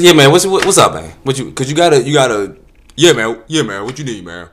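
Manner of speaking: loud street tough voice; mock tough guy. Comic to the solicitor, mock tough. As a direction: puff up and talk tough.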